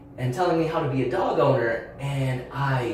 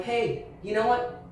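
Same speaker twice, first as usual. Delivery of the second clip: firm, higher pitched line drawing; reliving a telling-off of a stranger, firm and a touch higher; 8 semitones higher than usual. Retold or imitated. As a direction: set the boundary firmly. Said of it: "tell another adult".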